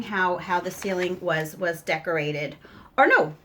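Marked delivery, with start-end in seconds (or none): 3.0-3.3 jumps up high and loud on a startled correction; catching herself mid-sentence, a sharp surprised stop; sudden surprise. Real, not acted. Mom catching her mistake showing a drawing, startled.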